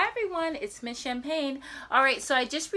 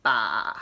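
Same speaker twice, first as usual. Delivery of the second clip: very low, loud bleat; goat bleat; 12 semitones lower, 3 dB louder than usual. Retold or imitated. Reviewing the sounds, very low bleat. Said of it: "let's see if I got the sounds right".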